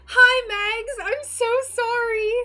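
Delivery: high, loud gushing hello and sorry; excited apology. Real, not acted. Streamer greeting a missed chatter, high and loud. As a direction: greet her in a burst, apologetic.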